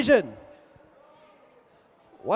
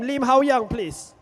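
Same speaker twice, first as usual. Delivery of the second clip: normal talking voice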